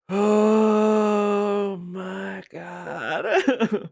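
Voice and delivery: long, stretched-out laughing groan; laughing moan. Real, not acted. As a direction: drag it out, weak from laughter.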